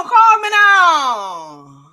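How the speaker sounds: loud, drawn-out mock Jamaican psychic cry; Miss Cleo. Host likens a bad accent to a TV psychic, hammed-up mimicry. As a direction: belt out a hammed-up TV psychic catchphrase, stretched slow. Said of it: "it sounds like Miss Cleo".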